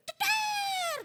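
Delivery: very high, loud mock bawl; imitated newborn cry. Mother imitating her newborn, loud comic wail. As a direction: blare out a sudden baby cry. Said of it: "gitu, nangis".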